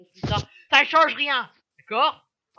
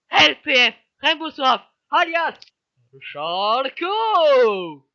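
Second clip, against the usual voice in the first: announcer; 3 semitones higher, 10 dB louder, much slower than usual. Acted. Announcing guest, applause.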